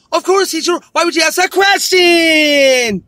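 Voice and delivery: put-on high, blaring child voice; mock little cartoon girl. Cartoon girl replies to worried teacher, high mock shout. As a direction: stretch the final word into a long shout. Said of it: "And the teacher's like".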